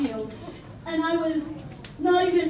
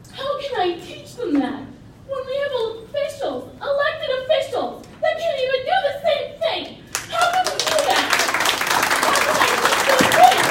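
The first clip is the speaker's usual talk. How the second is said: rising, louder, nearly crying; impassioned plea; 7 semitones higher, 5 dB louder than usual. Real, not acted. Teacher demands of the official, voice rising and cracking. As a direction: push the questions out harder each time.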